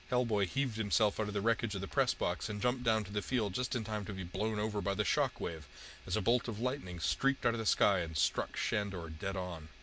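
ordinary delivery, nothing marked

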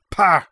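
a loud, high spat pah. A narrator's reading. Vice consul dismissing the mercenary with a loud spat pah. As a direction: spit the sound out in contempt. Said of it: "the vice consul spat".

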